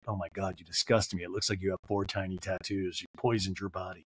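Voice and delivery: lightly voiced man, mildly disgusted; disgusted stripper. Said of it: "I wish the man would have been like".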